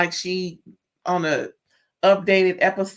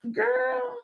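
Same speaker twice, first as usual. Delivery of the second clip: a pointed, knowing exclamation; 4 dB quieter than usual. Real, not acted. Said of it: "scolding aside".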